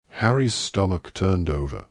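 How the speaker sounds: usual speaking voice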